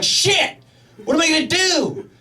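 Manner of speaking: high, mock-panicked yelp; a sudden mock panic, voice jumping high. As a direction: freak out at the disaster, hammed up. Panic at disaster.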